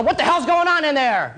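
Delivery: high-pitched, half-strength yell; mock police yell. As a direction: call out like police bursting in. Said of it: "police kick in your door and yell".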